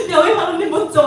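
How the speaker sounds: high, playful cheer of admiration; mock-enthusiastic praise; acted gushing praise. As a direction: act out an excited new believer. Acts out Gentiles praising God.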